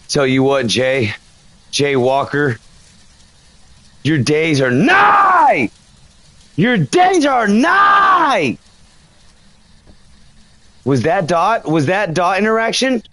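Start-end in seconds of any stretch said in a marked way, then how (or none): 4.0-5.7 dramatic proclamation. Pretend. Ghost hunter taunting the ghost Jay Walker, mock doom proclamation. 6.6-8.6 very slow, higher, hammed-up doom shout; higher dramatic proclamation. Ghost hunter repeating his taunt at the ghost, higher theatrical shout.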